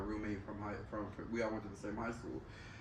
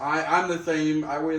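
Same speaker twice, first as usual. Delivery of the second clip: emphatic; 5 semitones higher, 12 dB louder, slower than usual. Real, not acted. Insisting on same weight.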